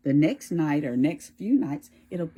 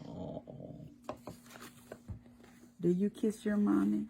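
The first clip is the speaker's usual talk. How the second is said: plain reading voice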